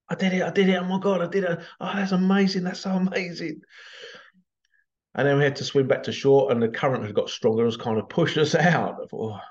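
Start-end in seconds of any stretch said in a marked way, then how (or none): 0.1-3.6 higher, thrilled imitating a girl's joy; excited daughter. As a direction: relive her squeal of triumph. Daughter's joy after the jump.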